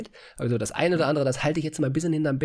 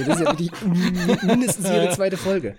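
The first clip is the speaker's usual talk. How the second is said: exasperated laugh while talking; 6 semitones higher, 5 dB louder than usual. Real, not acted. Frozen video again, laughing.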